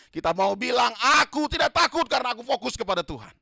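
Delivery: loud, high, driving sermon build; preaching at full force. Acted. Preacher rallying the church against fear, loud and high. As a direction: hammer it home to the congregation.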